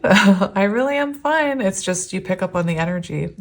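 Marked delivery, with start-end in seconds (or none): 0.0-1.6 laughing. Real, not acted. Laughing off her tears.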